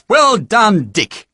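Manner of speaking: high, loud cheer; a hearty shout of well done. Acted. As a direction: call out the praise. Farm boys cheering a win.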